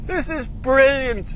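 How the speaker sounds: high, loud, drawn-out delight; elated. Real, not acted. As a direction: savour it, still giddy from laughing. Elated after the uphill surge.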